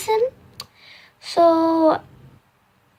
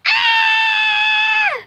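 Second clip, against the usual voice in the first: pained scream. Retold or imitated. Girl reliving pain at clinic, high pained scream. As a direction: scream as the wrench yanks it out. Yanking object out of her foot.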